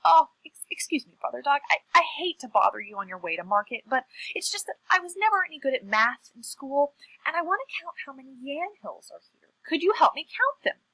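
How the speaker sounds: butter them up while acting helpless; polite pleading. Trickster feigns helplessness to Dog.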